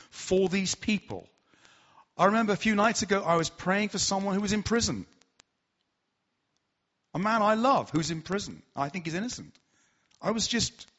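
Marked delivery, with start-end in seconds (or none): none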